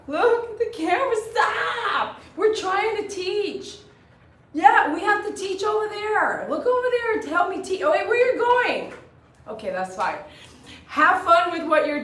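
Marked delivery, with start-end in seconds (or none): 0.1-1.1 high, laughing through the words. Real, not acted. Repeating request, breaks into laughter. 1.3-8.9 high, fast-rising pet talk; high-pitched excitement. Real, not acted. Scolding and coaxing the dog.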